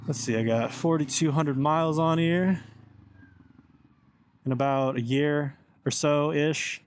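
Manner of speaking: ordinary talk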